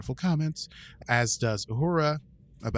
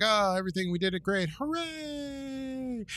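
mock cheer; 12 semitones higher than usual. Crew celebrating, mock cheer.

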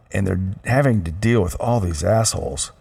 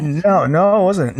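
high defensive denial; 9 semitones higher, 5 dB louder than usual. Real, not acted. Denying attitude to daughter.

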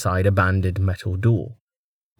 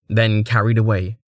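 ordinary delivery, nothing marked

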